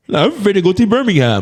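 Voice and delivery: high, laughing mock London accent; Londoner imitation.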